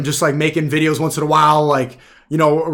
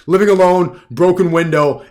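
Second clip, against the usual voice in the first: ordinary talk, nothing marked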